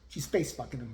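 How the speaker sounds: usual speaking voice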